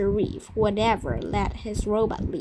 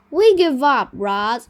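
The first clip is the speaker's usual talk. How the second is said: much louder call across the yard; shouting; 8 dB louder than usual. A narrator's reading. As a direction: shout it out, giving up. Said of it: "shouted Jed".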